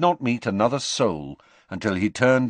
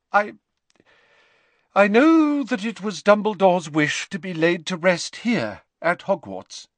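higher, briskness giving way to emotion; falter, then press on with a shaking voice; 10 semitones higher, 5 dB louder than usual. Acted. McGonagall on Dumbledore's wish to rest here, voice shaking. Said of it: "as her voice shook".

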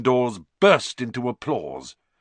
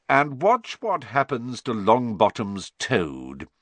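gloating Snape; 5 semitones higher, 3 dB louder than usual. Acted. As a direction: relish the coming failure. Snape gloats over toad.

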